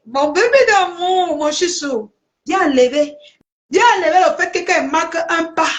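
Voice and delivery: high mock coo for a lover; mocking sugary plea. To her detractor, mimicking the rival's plea in high mock coo. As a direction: lay the fake sweetness on.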